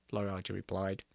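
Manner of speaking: ordinary delivery, nothing marked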